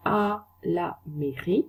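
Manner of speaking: à la mairie said very slowly, each sound clear; careful French pronunciation demo; slow French model. Shown as a demonstration. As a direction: spell out आ ला मेरी slowly like a teacher. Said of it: "pronunciation दोबारा से बता रही हूँ".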